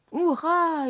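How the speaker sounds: high, loud hurrah; crowd yelling. A narrator's reading. Ratigan's subjects cheering his plan, yelling. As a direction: throw your voice up in a roaring cheer. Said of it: "hurle la foule".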